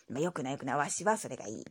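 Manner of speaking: lightly voiced old grandpa; grumbly old grandpa reply; old man voice. Grandpa character voice.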